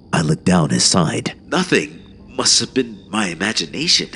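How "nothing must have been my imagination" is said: mildly defeated, pitched a little up; an understated giving-up. A narrator's reading. Runner gives up convincing the officer, mildly defeated. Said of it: "I was defeated".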